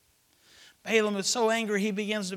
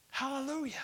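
usual speaking voice

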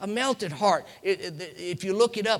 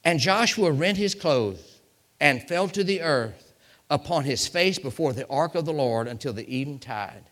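ordinary talk, nothing marked

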